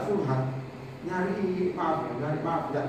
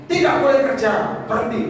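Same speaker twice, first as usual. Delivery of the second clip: commanding God's voice; 5 semitones higher, 8 dB louder than usual. Retold or imitated. Voicing God's command to quit.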